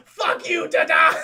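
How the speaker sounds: loud, high yelled curse; shouted insult. Retold or imitated. Lost man yells at his dying rescuer, loud and high. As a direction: yell it spitefully. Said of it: "Topher Grace goes".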